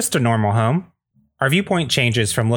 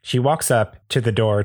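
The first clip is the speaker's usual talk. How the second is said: usual speaking voice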